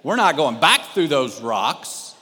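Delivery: hammed-up hard-headed husband refusing to turn back; husband shouting back at his crying wife, mock stern; mock gracious husband. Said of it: "me being the gracious, loving husband".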